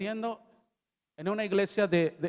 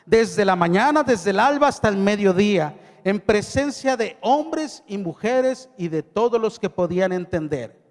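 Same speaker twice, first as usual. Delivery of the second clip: solemn Bible reading, a little louder; scripture reading; 4 dB louder than usual. Acted. Pastor reads Nehemiah to the congregation, solemn. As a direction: read the verses out to the church with weight.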